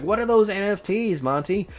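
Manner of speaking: high-pitched mock grandma question; grandmother voice. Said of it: "that's my grandmother voice".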